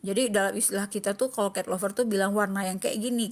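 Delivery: usual speaking voice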